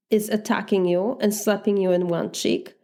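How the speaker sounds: ordinary delivery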